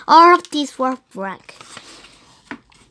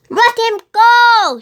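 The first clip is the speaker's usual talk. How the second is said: loud, high, slow demand; girl orders the pirate; fierce demand; 10 semitones higher, 7 dB louder, slower than usual. Acted. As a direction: make it an order.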